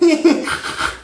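high nerdy snort-laugh; imitated high-pitched sitcom-nerd giggle; Steve Urkel. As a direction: squeal out a goofy nerd laugh. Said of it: "You see Urkel freaking".

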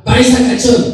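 high, loud jeer; shouted taunt. Acted. Upstairs neighbour yelling at the paisa, taunting shout. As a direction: heckle from overhead. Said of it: "le gritaba".

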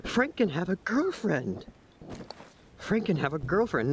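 excited gasp. Real, not acted. Excited gasp at severed head.

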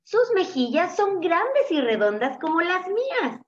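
excited high-pitched little chick; eager, high storybook chick; exclaiming. A narrator's reading. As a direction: exclaim it up high, full of hope. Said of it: "exclamó".

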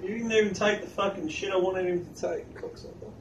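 usual speaking voice

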